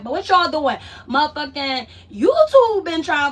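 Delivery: hollered greeting; loud call out. Real, not acted. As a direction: call out to the whole chat. Calling out to viewers again.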